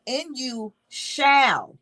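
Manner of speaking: very slow, "shall" stretched long and firm; stress on shall. Shown as a demonstration. Preacher applying the lawyer's emphasis to scripture, stressed "shall". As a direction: hang on "shall" as something that must happen. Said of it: "put an emphasis on that word".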